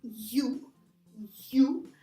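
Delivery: you as a clear isolated "yoo"; correct first sound. Shown as a demonstration. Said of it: "but it's".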